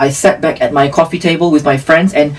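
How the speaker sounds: normal talking voice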